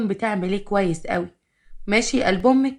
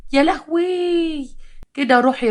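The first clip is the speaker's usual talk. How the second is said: much higher, very slow, swooning cry; delighted squeal; 7 semitones higher, slower than usual. Acted. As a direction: melt with pleasure at the scent. Mother-in-law delighted by shower gel. (شهقة إعجاب عالية وبطيئة جدًا)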